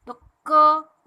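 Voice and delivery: क said loud, showing the क and अ sounds inside; split into sounds. Shown as a demonstration. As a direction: sound out क with its vowel. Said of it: "क का भी sound आ रहा है और अ का भी".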